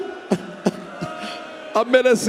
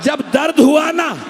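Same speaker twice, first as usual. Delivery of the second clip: ordinary talk, nothing marked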